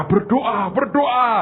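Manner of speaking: high, loud, fast pleading cry; urgent shouted warning. Acted. Older tree warning the young tree, shouting to pray. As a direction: shout the warning to save him. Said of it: "menasihati, berkata".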